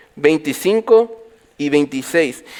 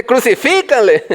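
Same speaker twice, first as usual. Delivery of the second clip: high mock yell with extra push; hammed-up crowd cry; imagined stronger shout; 7 semitones higher than usual. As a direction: act out joining the mob with more force. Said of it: "gritar más fuerte".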